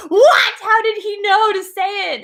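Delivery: high, loud, startled squeal; the friend caught completely off guard; shocked disbelief. Retold or imitated. Friend's shocked text, retold.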